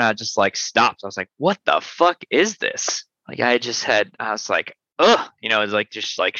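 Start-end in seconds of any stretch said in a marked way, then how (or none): 1.4-3.0 slow, high, stunned what-is-this; stunned disbelief. Retold or imitated. Recalling his first sour sip to the host, slow high disbelief. 5.0-5.3 high, loud retold grimace ugh; a mock yuck at a sour taste; sour-face ugh. Describing a friend's first sour sip, loud high ugh.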